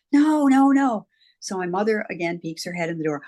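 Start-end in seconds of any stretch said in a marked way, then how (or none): 0.1-1.1 put-on higher, louder childlike no no no; a child's mock wail of refusal; imitated child protest. Small girl refuses to let Robbie go, higher louder cries. Child begging imaginary friend stay.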